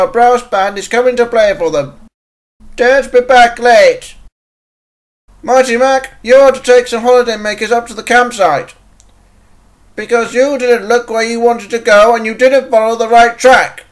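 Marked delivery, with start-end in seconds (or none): 2.7-4.2 loud, high, slow command; firm order. Acted. Controller warning an engine not to be late, firm order. 6.2-13.8 stern reprimand. Acted. Controller orders and reprimands engine.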